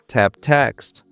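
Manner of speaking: normal talking voice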